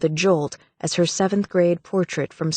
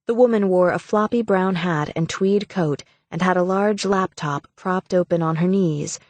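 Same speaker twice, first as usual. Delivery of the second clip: ordinary delivery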